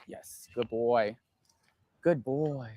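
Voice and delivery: slow, low, gentle praise for the dog. Real, not acted. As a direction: coo the praise to settle him. Praising the dog after success.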